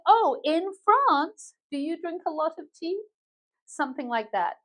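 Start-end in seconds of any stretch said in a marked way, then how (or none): 0.1-3.0 interested reply. Acted. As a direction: play a curious follow-up question. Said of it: "So you say".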